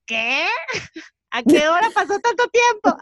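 chillido agudo de asombro fingido; incrédula. Retold or imitated. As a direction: reacciona en shock, exagerando, con la voz disparada hacia arriba. Acting out her shocked reaction. (chillido de sorpresa exagerado)

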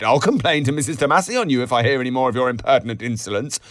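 fast, high, loud, haughty threat. A narrator's reading. Haughty barmaid threatening the boots boy, rapid and indignant. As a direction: rattle off the complaint with offended dignity. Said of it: "a haughty Bronze replied".

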